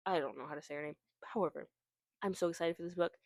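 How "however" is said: ordinary delivery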